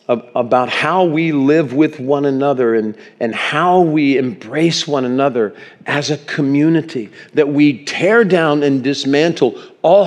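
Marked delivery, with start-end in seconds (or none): none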